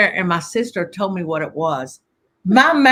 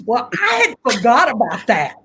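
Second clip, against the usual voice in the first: usual speaking voice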